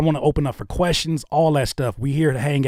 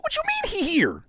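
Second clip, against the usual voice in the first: much higher, incredulous squeak; high-pitched disbelief. Retold or imitated. Streamer hearing the guest is in chat, high and stunned. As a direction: squeak it in shock. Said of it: "I said".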